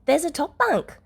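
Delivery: high, eager sales pitch; excitedly. A narrator's reading. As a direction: talk up the bunk to cheer her. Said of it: "Oboro says excitedly".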